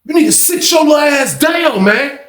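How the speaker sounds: high-pitched heated scolding. Retold or imitated. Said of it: "him and Ronald kinda get loud".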